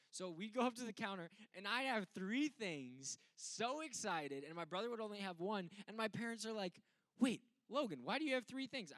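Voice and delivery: loud, high, animated storytelling; preaching at full force. Acted. Pastor tells the congregation the dollar store story, loud and high.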